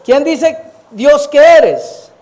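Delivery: every word stretched and loud; slow emphatic question. Acted. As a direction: drag it out so it lands. (pregunta lenta, fuerte y enfática)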